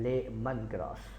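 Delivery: लेमन grass with a clean open "le"; लेमन said as "le-mun" plainly; clear le sound. Shown as a demonstration. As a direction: make the ले in लेमन grass crisp. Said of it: "ले को ले बनाना है".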